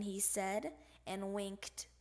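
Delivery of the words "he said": ordinary delivery, nothing marked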